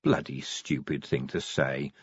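low, slow, soft private muttering; muttered to himself under the breath; low, slow inner voice; inner thought. A narrator's reading. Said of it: "Vimes thought".

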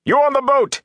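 very high, strained yell; calling out at the top of the voice; shouted hail. A narrator's reading. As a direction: hail a sleeping crew urgently. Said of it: "he shouted".

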